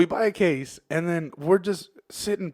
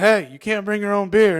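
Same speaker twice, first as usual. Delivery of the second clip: raised-voice bartender telling someone off; mock scolding; 7 semitones higher, 7 dB louder than usual. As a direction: call out a loud, high reprimand. Acted bartender scolding drinkers.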